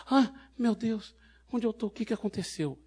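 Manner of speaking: bewildered woman. Retold or imitated. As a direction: imitate a woman waking up confused and alarmed. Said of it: "eu ouvi a voz dela".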